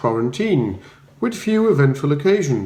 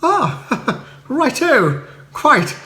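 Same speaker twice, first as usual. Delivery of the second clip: high, bright upper-class chirp; cheery Bertie; 9 semitones higher, much slower than usual. Acted. Bertie greeting Spode at the door, high and breezy. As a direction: greet him breezily, unbothered.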